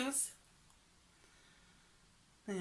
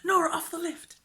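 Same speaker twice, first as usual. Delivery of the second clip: higher-pitched pretend snapper's tease; mock paparazzi; 7 semitones higher, 4 dB louder than usual. Vlogger jokes about stalking her friend, high mock paparazzi. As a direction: play at sneakily snapping a celebrity. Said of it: "You get, like, the sly picture".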